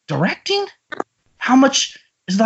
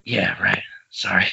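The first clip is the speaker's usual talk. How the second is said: much lower whispered sorry; whispered apology; 11 semitones lower, 6 dB quieter than usual. Acted. Emilio apologizing to Demi, whispered. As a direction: whisper it sheepishly.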